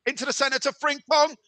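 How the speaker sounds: high-pitched, urgent play-by-play; animated match commentator; excited football commentary. Acted. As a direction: call the attack, bursting on the near miss. Live play-by-play at a near chance.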